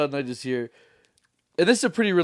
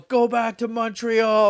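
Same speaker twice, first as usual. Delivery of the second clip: slow, mock heckling yell, higher; heckler yell; 8 semitones higher, 4 dB louder, slower than usual. Heckler yelling at the comedian, mock yell. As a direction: drag out the jeer from the crowd.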